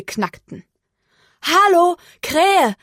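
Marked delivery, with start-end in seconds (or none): 1.4-2.8 high, loud cow's call to a friend. A narrator's reading. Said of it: "rief sie". (hohes, lautes Rufen)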